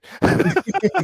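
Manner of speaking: shrill burst of laughter; high laugh. Real, not acted.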